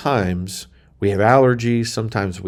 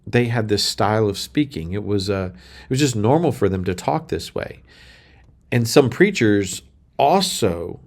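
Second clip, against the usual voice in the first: usual speaking voice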